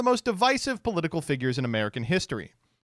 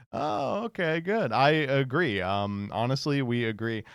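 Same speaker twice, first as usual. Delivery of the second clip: ordinary talk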